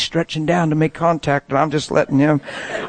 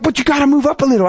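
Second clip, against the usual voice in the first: rushed, higher, pushy imitation; aggressive urging; 9 semitones higher, 4 dB louder, much faster than usual. Passenger pressing driver in traffic jam, aggressive. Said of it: "he's so aggressive".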